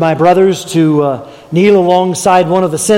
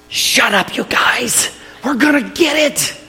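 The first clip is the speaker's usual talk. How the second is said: higher, hushed urgent warning; hushing older brother; 7 semitones higher, 3 dB quieter, slower than usual. Retold or imitated. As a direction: hush them before Dad hears. Brother hushes the others, scared.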